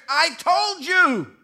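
loud, slow, insistent; insistent wife. Retold or imitated. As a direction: lean on told as if sure. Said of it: "she swears".

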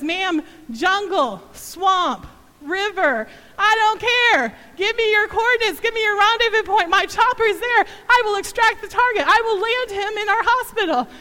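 loud, high barked military orders; commanding pilot. Retold or imitated. Pilot overriding the mother's fears, loud commanding. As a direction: bark it like a Vietnam chopper pilot. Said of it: "strong voice came across the phone".

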